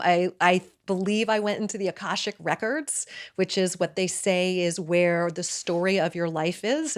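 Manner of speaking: usual speaking voice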